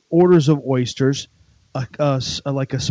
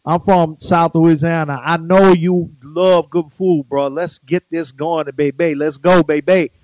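louder, slow, hammed-up Cajun accent; 3 semitones higher, 6 dB louder, slower than usual. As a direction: sell it like a South Louisiana good old boy. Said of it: "What if I came with Cajun accent?".

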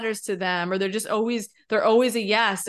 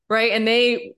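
usual speaking voice

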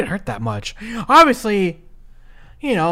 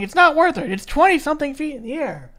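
imitated yell; 8 semitones higher than usual. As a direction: retell a commentator's high-pitched warning. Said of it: "even J B L is yelling".

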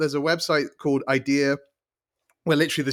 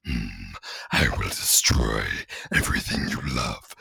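menacing villain. Acted. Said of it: "she'll hear me being like".

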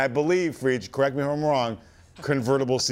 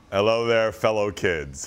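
deep mock old man; 7 semitones lower than usual. Host mocks being old.

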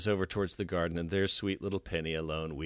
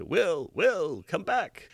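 calling out; 10 semitones higher, much slower than usual. Retold or imitated. Doctor Smith calling after Will.

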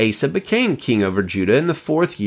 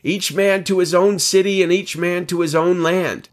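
army's cry; 8 semitones higher than usual. A narrator's reading. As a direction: call it out high like a herald. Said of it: "the cry rang out in the army".

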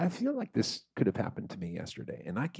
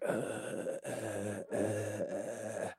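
weak, soft repeated croaked "uh"; desert-crawler groan; 11 dB quieter, much slower than usual. Acted. Imitating movie dudes walking through the desert, weak groaning. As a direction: spent little groans, softly. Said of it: "walking through the fucking desert and they're like".

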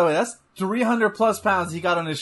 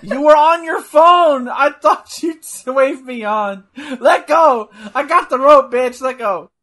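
angry shouting wrestler; 6 semitones higher, 8 dB louder than usual. Pretend. Voices wrestler fighting over rope.

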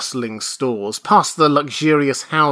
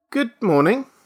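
high, tentative greeting; nervous; 11 semitones higher, 5 dB louder than usual. A narrator's reading. Father greets the clerk, nervous. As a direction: greet with nervous over-brightness. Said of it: "Mister Button said nervously".

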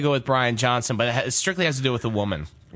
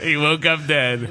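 ordinary talk, nothing marked

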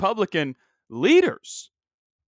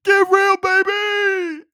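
put-on even higher, shouted get real, baby; bigger Dick Vitale catchphrase; 8 dB louder, much slower than usual. Podcaster repeats the catchphrase, pushing his announcer impression further. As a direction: crank the catchphrase to a squealing peak. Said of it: "This is my impression of Dick Vitale".

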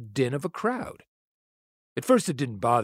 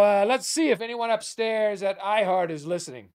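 usual speaking voice

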